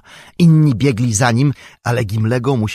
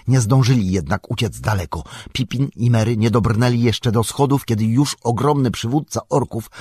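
usual speaking voice

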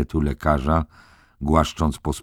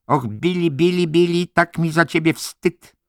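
high, raised voice of a disappointed matron; shaming reproach; 4 dB louder than usual. A narrator's reading. Head nurse shaming Billy before the patients, reproachful. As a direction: shame him with a lamenting reproach.

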